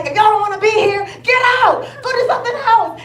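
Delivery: loud, high, laughing 'get out'; half-laughing as you shout them out of the room; mock telling off. Retelling kicking negative people off her live, with a loud, laughing mock yell. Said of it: "And I'm like".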